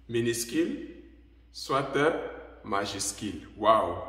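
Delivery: ordinary delivery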